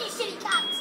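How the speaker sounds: normal talking voice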